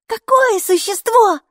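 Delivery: put-on high, loud, slow amazed child cry; a little girl gasping out the question; childlike exclamation. A narrator's reading. Mikey exclaims at the letter, high loud child voice. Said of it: "воскликнула Майки".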